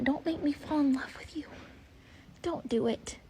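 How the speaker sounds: pleading soft whisper; hushed plea. Real, not acted. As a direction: beg the foal in a whisper. Whispering to the foal.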